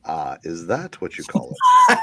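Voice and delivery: ordinary talk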